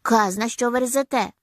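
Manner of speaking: mildly furious retort; angry outburst. A narrator's reading. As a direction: raise your voice in exasperation. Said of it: "Серсі аж ногами затупав".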